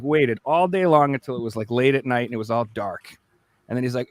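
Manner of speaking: normal talking voice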